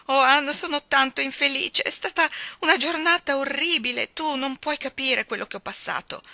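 put-on young woman close to tears, high and pleading; distraught young girl. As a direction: play Dovi as a weepy, overwrought young bride. Dovi's voice in story.

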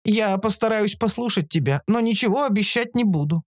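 high-pitched, guarded creature; creature Оша conceding. A narrator's reading. Оша half agreeing with the narrator, guarded.

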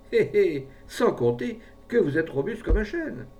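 high, loud knowing chuckle-words; sly flattery. A narrator's reading. Pharmacist flatters his hesitant client about his health, coaxing heartily.

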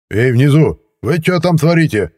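high, loud shout down from a window; neighbour yelling from above. A narrator's reading. Neighbour shouting down from upstairs at the bench. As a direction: holler down at the pair on the bench. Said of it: "раздался голос откуда-то сверху".

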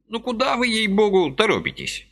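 put-on high fussy old man's voice, gently reproaching; a little old man chiding kindly; old man reproaching Vasily for his haste, high and fussy; reproachful old man. A narrator's reading. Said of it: "укоризненно проговорил он".

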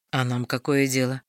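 low, gloomy grumble. Acted. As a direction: sullen and dismissive. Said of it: "мрачно поинтересовался Дарвальд".

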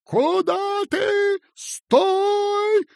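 very high, drawn-out shout; fearsome yell. A narrator's reading. Small boy stopping intruders on the landing, fearsome yell. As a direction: shriek the challenge at full pitch. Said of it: "страшно закричал".

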